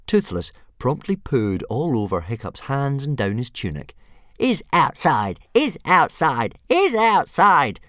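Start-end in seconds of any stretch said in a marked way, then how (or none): 4.4-7.8 slow, high gloat climbing ever louder; crowing dragon. A narrator's reading. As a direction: crow it in triumph, building each time. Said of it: "crowed Toothless".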